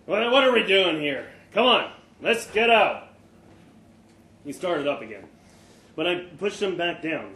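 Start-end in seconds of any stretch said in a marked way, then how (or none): 0.0-3.1 louder and higher, a confused father pushing to get out of bed; agitated urge to leave. Acted. Delirious father in hospital bed urges his son to go, agitated. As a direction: blurt it out, restless and disoriented.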